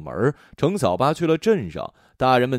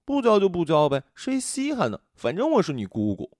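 lightly voiced girl, a touch pouty; sulky girl; 7 semitones higher than usual. A narrator's reading. Girl walking off, lightly pouty. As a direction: toss it off like a miffed girl. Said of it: "英子掉头下山".

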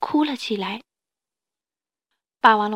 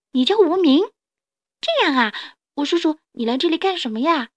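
put-on higher, eager baby dinosaur voice; curious little dinosaur; 5 semitones higher, 5 dB louder than usual. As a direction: ask brightly as an inquisitive small dinosaur.

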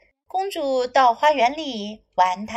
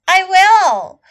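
high, loud eager princess; quick eager storybook yes; cartoon princess; 7 semitones higher, 9 dB louder than usual. Princess agrees.

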